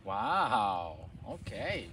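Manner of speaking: usual speaking voice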